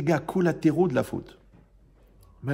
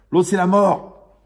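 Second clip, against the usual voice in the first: preacher proclaiming, slow and loud; slow loud pronouncement; 4 semitones higher, 8 dB louder, much slower than usual. Real, not acted. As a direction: drop it slow and loud like a verdict. Preacher's dramatic punchline.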